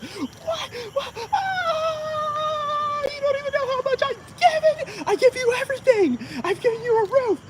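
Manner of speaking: high wailing mock mom voice; dramatic crying mom. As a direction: sob it out like an overdramatic mom. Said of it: "my mom does that shit".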